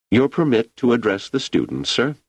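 usual speaking voice

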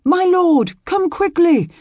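high, loud urgent call; urgent shout. Acted. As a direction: shout for help in a rush. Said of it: "Sir Rolf's voice stopped him".

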